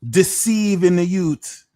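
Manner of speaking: high, loud mock Jamaican accent; attempted Jamaican accent. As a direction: call it out slowly in an amateur Jamaican accent. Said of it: "I wish I mastered a Jamaican accent".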